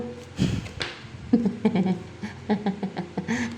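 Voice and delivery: long breathy chuckle; real, quiet laughter; amused laugh. Real, not acted. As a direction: let a soft giggle run on. Amused by her Cupid remark.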